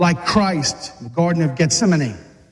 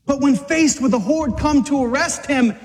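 usual speaking voice

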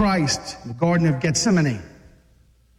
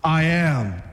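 lowered voice, mild swagger; manly swagger; 4 semitones lower than usual. Acted. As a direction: play it low and cocksure, lightly. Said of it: "He had the man swagger too".

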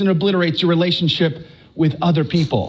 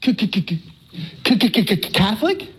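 stuck on the word in disbelief; shocked stutter. Retold or imitated. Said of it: "And I went".

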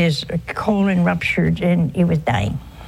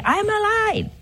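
high insistent cry. Retold or imitated. Dead man to wife who can't hear him, high insistent call.

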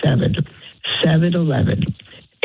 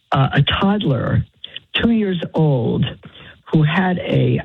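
usual speaking voice

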